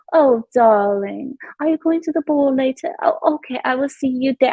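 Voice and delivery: high posh British chatter; posh Londoner impression. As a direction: play a prim upper-class Brit. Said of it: "conversations like".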